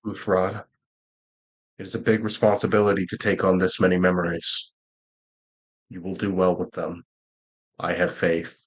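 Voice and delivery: low, measured reassurance from an elder; solemn old guardian. Acted. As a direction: entrust it gravely, pitched low. Old guardian encourages Marcius.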